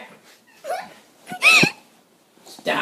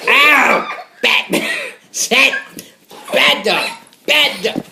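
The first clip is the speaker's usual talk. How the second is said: normal talking voice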